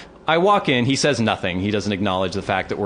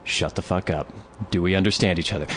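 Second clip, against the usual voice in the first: low, quieter controlled order; stern retold warning; 4 semitones lower, 4 dB quieter than usual. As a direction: turn back and shut him down quietly. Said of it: "So I turn around again".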